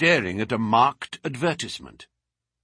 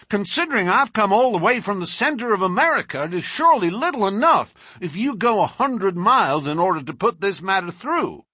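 louder, quick heated protest; hotly; 10 semitones higher, 4 dB louder than usual. A narrator's reading. Lawyer scolding the collector for refusing, heated. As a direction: press him hard, indignant. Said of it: "he added hotly".

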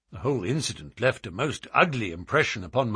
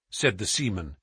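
ordinary delivery

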